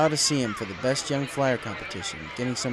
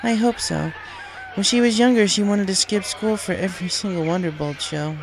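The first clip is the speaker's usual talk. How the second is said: higher, lighter voice for the mother; mother's voice; 7 semitones higher than usual. A narrator's reading. Mother recalls her daughter, in a higher voice. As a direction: voice the mother, wistful and fond. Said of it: "Firefly said".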